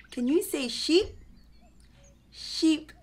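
usual speaking voice

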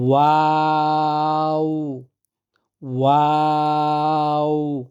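slow ওয়াও, lips round, open, round again; ওয়াও like a bud opening and closing; Arabic waw. Shown as a demonstration. Teacher telling students to watch closely, slow waw. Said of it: "খুব ভালোভাবে লক্ষ্য করুন".

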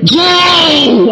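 long, high-held call of the name; Grandma calling her. Retold or imitated. Grandma greeting grandchild at daily visit, drawn-out call. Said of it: "grandma looked at me and said".

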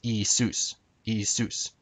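Iisus said ee-SOOS; modern Greek pronunciation. Shown as a demonstration. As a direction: say Iisus as ee-SOOS, accent on the end. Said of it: "Jesus would be pronounced".

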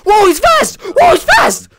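very high, loud yelp of alarm; panicked shout. Real, not acted. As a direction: yell it out as it charges at you. Monster rushes at player.

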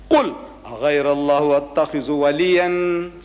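measured Arabic chant with held endings; Quran recitation. Real, not acted. Preacher quotes what Allah says, reciting. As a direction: recite it as sacred verse.

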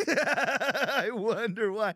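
high, very slow and drawn out with laughter; mock wondering, laughing. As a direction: stretch each word out through a laugh.

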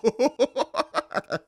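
helpless high laugh; high burst of laughter. Real, not acted. Vlogger cracking up at the clip, high laugh.

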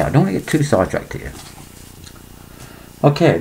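normal talking voice

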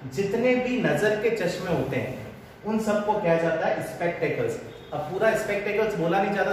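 usual speaking voice

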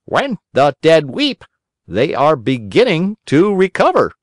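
a high, mildly solemn pronouncement; solemn crow. A narrator's reading. Crow doctor solemnly answers the weeping, high voiced. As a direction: declare it as a grave crow. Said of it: "said the crow solemnly".

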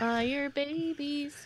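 slow deliberate repeat. Acted. Player repeats the name, slow.

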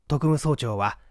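ordinary delivery

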